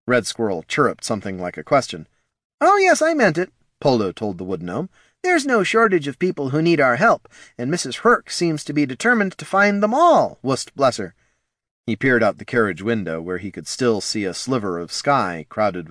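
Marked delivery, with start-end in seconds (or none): 2.6-3.5 very high, eager character voice; Polo, earnest. Acted. Polo assuring the Wood Gnome, high and eager. 5.2-11.1 lightly raised fond character voice; a higher voice, fondly; Polo's voice. Acted. Polo speaking of Missus Herc, lightly higher and fond.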